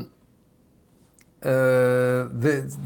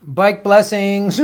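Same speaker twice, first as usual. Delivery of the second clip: drawn out; 5 semitones higher, much slower than usual. Real, not acted. Drawn-out sign-off phrase.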